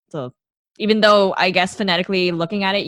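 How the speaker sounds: usual speaking voice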